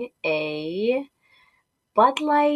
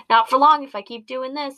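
ordinary talk

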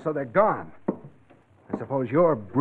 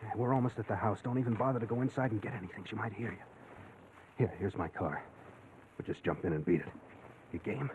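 hushed sneaking; 2 semitones lower, 11 dB quieter than usual. Acted. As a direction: keep it down, sneaking past the house. Sneaking past sleeping mother.

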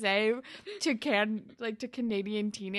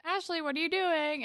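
high-pitched pleading voice; imitated concerned friend; 8 semitones higher than usual. Terry trying to intervene with Ashley, high imitated concern. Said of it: "Terry tries to intervene".

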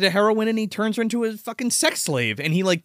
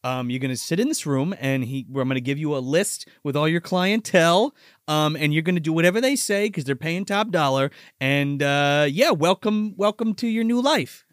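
lower, calm, menacing deal; low menacing captor; 7 semitones lower than usual. Retold or imitated. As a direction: lay out the deal coldly and low. Captor explains deal to victim.

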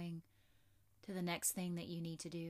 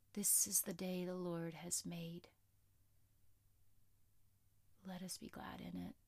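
soft spa whisper; 3 dB quieter than usual. Acted. Whispered devotional line in shavasana.

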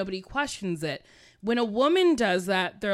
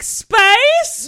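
squeaky, much higher and louder "Space?"; high, loud baffled squeak; mock dumb woman; 12 semitones higher, 12 dB louder than usual. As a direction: play a clueless woman gaping at the word. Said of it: "one of those dumb women who's like".

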